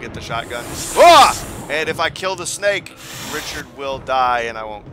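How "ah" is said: short, loud, high yelp; sharp cry of alarm; startled yell. Real, not acted. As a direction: yelp suddenly mid-explanation. Startled mid-explanation during the game.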